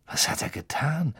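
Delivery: hushed, whispered anxious question; soft, breath-only worry; nervous. A narrator's reading. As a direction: whisper it uneasily. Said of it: "fragte Harry nervös".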